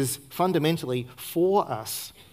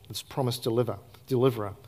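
ordinary talk

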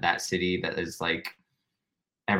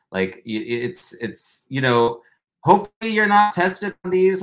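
ordinary delivery

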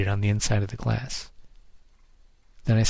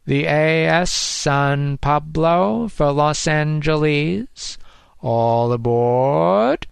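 slow, louder loudspeaker boarding call; amplified announcer; 5 semitones higher, 4 dB louder, much slower than usual. A narrator's reading. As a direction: stretch out aboard like a tannoy. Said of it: "the amplified voice of the terminal announcer".

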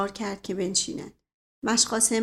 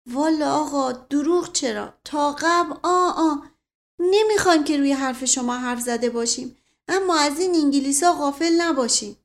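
higher, louder character voice for a simple servant; stubborn loyal servant; 5 semitones higher, 4 dB louder than usual. Acted. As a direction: protest earnestly, pitched up and loud.

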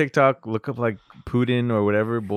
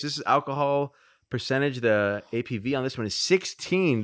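ordinary talk

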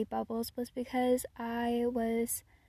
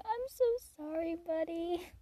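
put-on much higher, sweet voice to a toddler; tender high apology; 6 semitones higher than usual. Leaving the boy who held her hand, tender high apology. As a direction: soothe a crying little boy. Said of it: "and I'm like".

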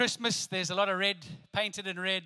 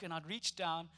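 usual speaking voice

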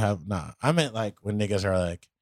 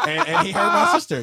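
raised, heated voice; angry outburst; 8 dB louder than usual. Real, not acted. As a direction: let the anger flare up. Angry about his sister.

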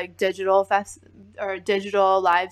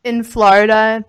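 ordinary talk, nothing marked

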